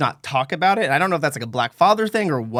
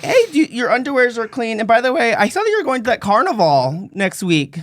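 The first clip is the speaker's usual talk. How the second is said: mom; 8 semitones higher than usual. Retold or imitated. Mom voiced in story.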